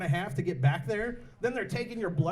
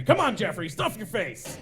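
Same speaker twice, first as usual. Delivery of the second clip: loud mock-coach shout; rowdy cheering on; 3 semitones higher, 4 dB louder than usual. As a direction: holler at your champion to keep going. Acted shouting at eating contestant.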